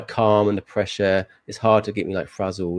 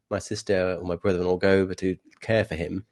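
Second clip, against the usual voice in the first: usual speaking voice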